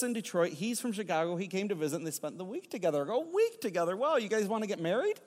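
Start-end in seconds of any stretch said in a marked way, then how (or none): 3.2-5.2 high-pitched, nosy astonishment; playfully blurted, pitched-up surprise; mock amazement. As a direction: jump on the news with a high, leading question. Blunt reaction to the couple's news.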